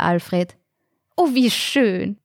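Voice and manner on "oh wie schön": higher, slow and pleased; a charmed old dancer's coo; delighted. Acted. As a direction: savour the words with delight. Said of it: "freute sich Mechthild".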